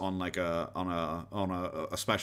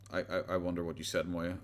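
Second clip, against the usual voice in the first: usual speaking voice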